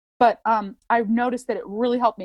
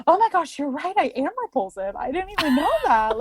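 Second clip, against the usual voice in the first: high, wide-eyed gasp of belief; mock-innocent high voice; mock naive young self; 6 semitones higher than usual. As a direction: play a gullible kid hearing she's repulsive.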